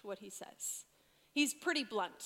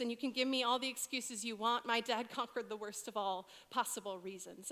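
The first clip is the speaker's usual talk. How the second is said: ordinary talk, nothing marked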